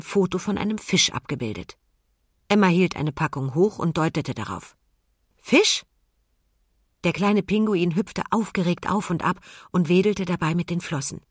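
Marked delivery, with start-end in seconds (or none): 5.4-5.8 hohe, deutlich angehobene Frage; fragend. A narrator's reading. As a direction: frag es kurz und hoch. Emma zeigt fragend aufs Bild. (hoch und fragend gesprochen)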